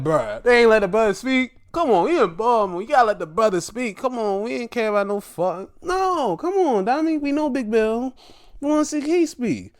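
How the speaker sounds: high-pitched, quick protest; indignant pleading. Retold or imitated. Fan urging them to let Keith speak, indignant.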